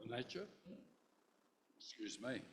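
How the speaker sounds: talking through a mouthful; words round a bite of cheese; mouth full. Real, not acted. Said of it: "I'm eating my cheese and bickies".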